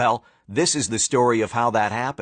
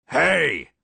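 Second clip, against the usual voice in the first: coach shout; 2 semitones higher than usual. Acted. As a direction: bellow like a coach. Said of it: "shouted the coach".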